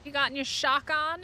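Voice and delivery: usual speaking voice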